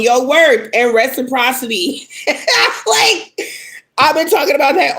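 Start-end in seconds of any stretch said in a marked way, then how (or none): 2.1-2.8 airy disbelieving laugh, much higher; exasperated little laugh; scoffing giggle; breathy high laugh. Real, not acted.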